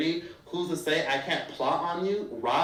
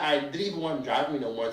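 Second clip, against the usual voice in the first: ordinary talk, nothing marked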